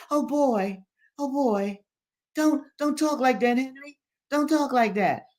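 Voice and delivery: put-on high, fretful old man; dismayed Daddy Joe. A narrator's reading. Old man begs Henry not to talk so, dismayed. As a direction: plead in a high voice, repeating yourself.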